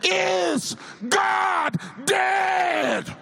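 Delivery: high, very slow holler on GOD; hollered question. Retold or imitated. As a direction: stretch GOD into a high cry. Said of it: "hollers from the back".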